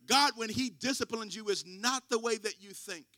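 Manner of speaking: loud, high preacher's call to the pews; loud Sunday sermon delivery; full-force preaching. Acted. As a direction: preach it out, rising to the room. Preaching to congregation, raised voice.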